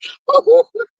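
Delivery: a sudden high burst of laughter; loud high laugh. Real, not acted. Laughing at chat roast.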